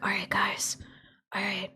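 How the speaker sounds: whispered close to the mic; spooky whisper. Shown as a demonstration. Streamer showing how to whisper in spooky game sections. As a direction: hush it like sneaking through a spooky game. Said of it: "pull your microphone a little bit closer".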